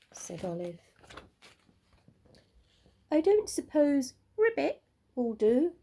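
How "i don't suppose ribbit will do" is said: higher, lightly voiced frog; a hint of a hopeful frog; frog asking. A narrator's reading. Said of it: "asked the frog".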